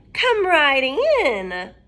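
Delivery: slow, loud sweet-sly witch invite. Acted. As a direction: lure the child in slowly. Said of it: "said the witch".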